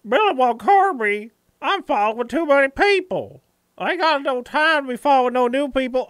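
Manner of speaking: high-pitched hillbilly whine of a follower; mock complaining viewer. Streamer mimicking a follower's excuse, mock complaint. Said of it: "You say".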